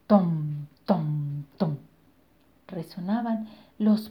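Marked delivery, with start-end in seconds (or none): 0.1-1.8 low booming tom tom; low footstep booms. Acted. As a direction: thud out the giant's steps. Giant footsteps sound. (pasos graves y retumbantes)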